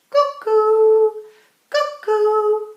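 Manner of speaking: loud, high two-note cuckoo call; cuckoo clock. A narrator's reading. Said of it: "went the living room clock".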